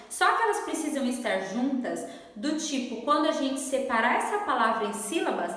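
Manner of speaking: ordinary talk, nothing marked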